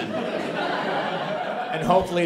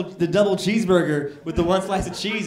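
normal talking voice

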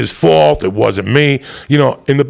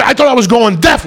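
usual speaking voice

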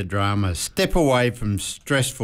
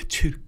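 mock sneeze; 3 dB quieter than usual. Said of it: "joking about World Allergy Day".

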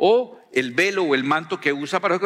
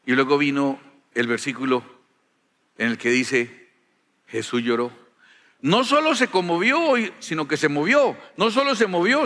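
usual speaking voice

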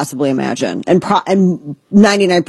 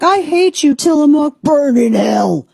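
mock angry fan; 9 semitones higher than usual. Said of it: "and people are like".

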